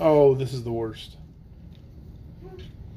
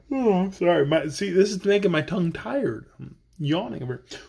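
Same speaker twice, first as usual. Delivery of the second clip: ordinary delivery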